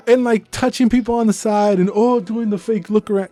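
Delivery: mock outrage, cracking high; incredulous rant. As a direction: rant in disbelief, voice way up. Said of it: "I'm like".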